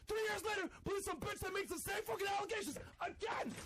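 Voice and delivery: high, shouted furious rant; angry shouting. Real, not acted. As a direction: yell it like you've lost it. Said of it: "He's not emotional".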